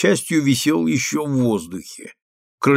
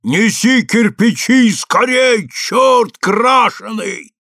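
high, loud, drawn-out holler; rough yell; 10 semitones higher, 4 dB louder, slower than usual. A narrator's reading. Workman's voice from the wall orders bricks, yelling roughly. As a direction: bellow an order, stretching the words. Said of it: "вдруг заорал из стены грубый голос".

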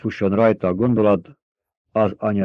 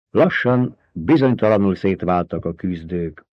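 plain reading voice

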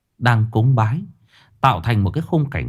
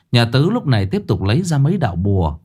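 usual speaking voice

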